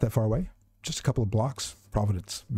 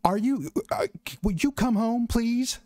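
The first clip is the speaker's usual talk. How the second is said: much higher, pleading mother's voice; begging her child to come home; pleading mom; 11 semitones higher than usual. Acted. Mom pleads with Elliot to come home, high and desperate.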